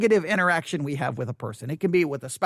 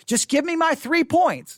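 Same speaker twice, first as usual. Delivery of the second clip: impatient listener; 7 semitones higher than usual. Retold or imitated. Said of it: "I know you're like".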